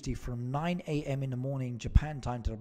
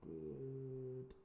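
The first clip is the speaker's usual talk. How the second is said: very soft, slow aside; half-sung murmur while browsing; muttering to self; 17 dB quieter, slower than usual. Real, not acted. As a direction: think aloud, barely audible. Talking to self while searching.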